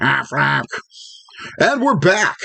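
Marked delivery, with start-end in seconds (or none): 0.0-0.6 quacking the brand name like a duck. Pretend. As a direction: squawk it out in a duck voice. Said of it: "make fun of the Ducks voice".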